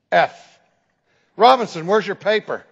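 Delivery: loud demand for the paper; stern demand. Retold or imitated. Professor going down the list of missing papers, loud and stern. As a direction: call the student out firmly. Professor failing students in turn.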